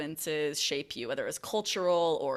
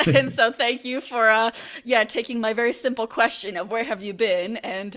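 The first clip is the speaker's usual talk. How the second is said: laughing thanks, higher and louder; 5 semitones higher, 6 dB louder than usual. Real, not acted. Host teasingly thanks the guest, laughing, higher and louder. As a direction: chuckle through the thank-you.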